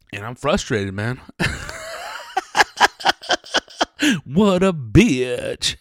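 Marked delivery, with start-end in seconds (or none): 1.2-4.2 long breathy laugh squeaking very high. Real, not acted. Podcaster laughing at his own frustration, long airy high laugh. 4.3-5.8 exasperated. Real, not acted. Exasperated outburst.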